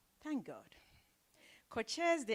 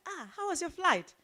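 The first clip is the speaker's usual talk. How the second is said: someone's friendly question; 11 semitones higher, 5 dB louder than usual. Retold or imitated. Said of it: "they was like".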